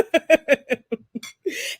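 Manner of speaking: laughing. Real, not acted. Laughing at story.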